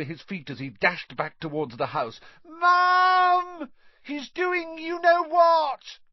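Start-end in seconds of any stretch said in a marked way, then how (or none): 2.4-6.0 high-pitched, loud tattling wail; howling. A narrator's reading. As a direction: howl to mum, dragging out the words. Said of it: "howled Dudley".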